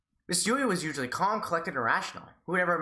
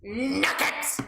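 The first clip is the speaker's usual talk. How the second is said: goofy outburst; 10 semitones higher than usual. Pretend. Joking outburst.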